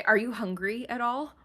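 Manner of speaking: normal talking voice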